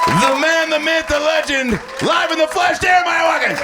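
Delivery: high, shouted hype-man intro; bringing up the host, big announcer shout. Acted. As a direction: build it up like a ring announcer. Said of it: "Let's bring up your host".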